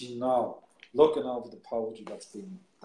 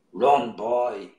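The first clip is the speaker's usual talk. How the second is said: put-on Run, boy called out loud; calling out; 8 dB louder than usual. Poet urging the boy in the poem, called out loud. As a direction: call it out like urging him on. Poem line repeated, louder.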